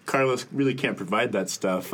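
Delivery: usual speaking voice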